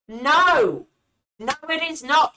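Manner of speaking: loud, high, emphatic denial; exasperated outburst. Real, not acted. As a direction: burst out in real indignant protest. Exasperated rebuttal of the played clip.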